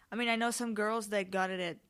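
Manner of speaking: ordinary talk, nothing marked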